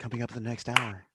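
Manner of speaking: mock a smooth AM radio link. Co-host joining the joke announces what's next, AM radio voice. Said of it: "you just went into A M radio voice".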